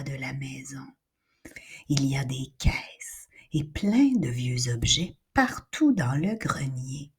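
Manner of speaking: hushed, low narration in a whisper; suspense whisper. A narrator's reading. Narrator whispering as they enter the attic.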